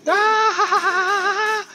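loud high cry of pain and alarm; sharp scream. Real, not acted. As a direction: scream as the blow lands. Hit in fight. (výkřik leknutí)

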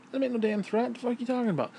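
retold outrage at the police; indignant. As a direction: scoff at the accusation. Repeats outraged reply.

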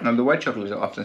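eating snacks while speaking; talking while chewing. Real, not acted. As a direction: hand near the mouth. Seen 0: man in red holds food near mouth while talking, gestures after.

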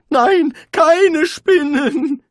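high, loud, slow sobbing plea; terrified wizard wailing against the spiders. A narrator's reading. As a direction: beg through tears. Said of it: "jammerte Rincewind".

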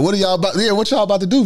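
high-pitched, confused questioning; retold bewilderment. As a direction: replay being thrown, pitch climbing. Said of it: "I'm not even really totally understanding".

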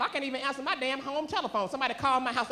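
ordinary talk

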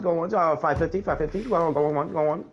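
lightly hammed-up auctioneer. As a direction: rattle it off like an auction. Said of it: "you sound like an auctioneer".

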